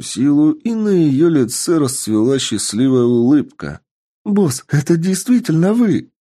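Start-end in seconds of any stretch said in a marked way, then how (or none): 4.2-6.1 put-on excited elf girl. Elf overjoyed recognising the boss.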